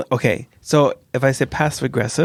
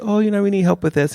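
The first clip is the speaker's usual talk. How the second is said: colleague asking for help; 8 semitones higher than usual. Retold or imitated. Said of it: "someone comes and says".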